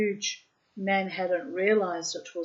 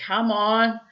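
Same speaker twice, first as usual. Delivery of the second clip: hearty coaxing; 7 dB louder than usual. A narrator's reading. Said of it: "cried God".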